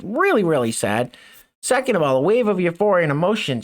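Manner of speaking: ordinary delivery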